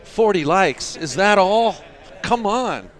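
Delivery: loud, high-pitched playful disbelief; mock outrage. Streamer chiding viewers over likes, mock outrage. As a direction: scold them in jest, voice pitched high.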